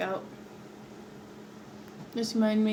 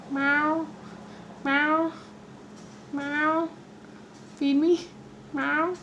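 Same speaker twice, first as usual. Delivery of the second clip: high playful meows; high cute begging meows; cat meows; 6 semitones higher, much slower than usual. Pretend. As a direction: meow like a hungry cat begging. Meowing like a cat for food.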